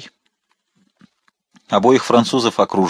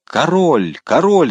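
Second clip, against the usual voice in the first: high, falling cries, growing louder; shouting; 7 semitones higher, 4 dB louder, slower than usual. A narrator's reading. Englishmen mistaking Winter for the king, shouting. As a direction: cry out the sighting twice, second louder. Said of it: "закричали несколько англичан".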